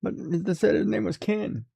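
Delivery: put-on high whiny child pleading; whiny kid voice. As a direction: play a sulky kid begging mom. Mock child pleading with mom.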